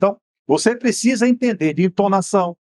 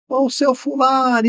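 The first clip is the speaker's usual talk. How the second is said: retold shout; 6 semitones higher, slower than usual. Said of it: "aí daqui você grita".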